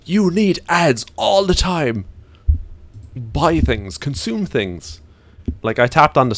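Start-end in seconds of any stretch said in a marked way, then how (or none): 0.1-5.0 high, slow, pushy parody voice; mock advertiser voice. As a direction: parody a greedy ad pitch.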